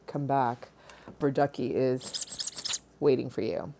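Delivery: slow, soft, coaxing; beckoning softly. Pretend. Streamer calling viewers back, slow soft coaxing. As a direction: lure them back, slow and low.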